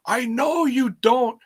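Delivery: mock-patient talk to someone with little English; Russo talking slowly. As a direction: spell it out as if to a foreigner. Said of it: "like, talk real slow to him".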